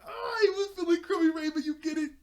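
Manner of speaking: high, loud mock wail; over-the-top joke punchline. Beast Boy pushing his joke on Raven, shrieking. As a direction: shriek the punchline, hammering it home.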